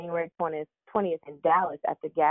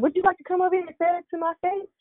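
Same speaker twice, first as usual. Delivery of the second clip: retold challenge; 13 semitones higher, faster than usual. Wrestler daring the heckler across the street, mock threatening. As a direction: dare him to cross the street and say it.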